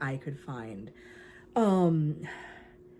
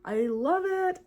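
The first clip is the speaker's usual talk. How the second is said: high, bright delight at the starfish; delighted squeal; 10 semitones higher, 3 dB louder, slower than usual. Real, not acted. Crafter shows off her starfish, squealing delight. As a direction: gush over the giant sea star.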